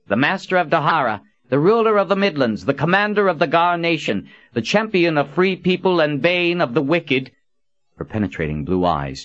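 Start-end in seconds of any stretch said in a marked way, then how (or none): 0.1-7.3 high, formal herald announcement; officious. A narrator's reading. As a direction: proclaim it like a stiff court herald. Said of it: "Verdine said in an officious tone".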